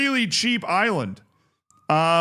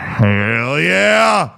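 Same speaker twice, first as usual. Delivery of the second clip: loud cheer; 2 semitones lower, 7 dB louder than usual. Real, not acted. Cheering a sub.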